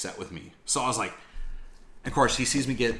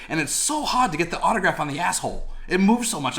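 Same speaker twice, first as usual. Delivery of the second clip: higher, louder mock British accent; overdone British accent, getting louder; bad British accent; 8 semitones higher, 5 dB louder than usual. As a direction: ham up a British accent, rising to the end. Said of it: "I'm gonna fuck up a British accent here".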